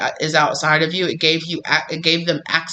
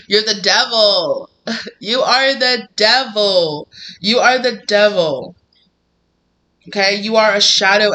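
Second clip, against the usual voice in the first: slow, emphatic, higher and louder accusation; accusing the devil; 5 semitones higher, 4 dB louder, slower than usual. Acted. Telling the shadow self it is the devil, slow accusation. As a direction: point the finger and drag it out.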